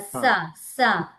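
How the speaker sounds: సా with tongue touching the teeth; isolated dental సా. Shown as a demonstration. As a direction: sound out సా twice, hissed on the teeth. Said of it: "దంతాలకి".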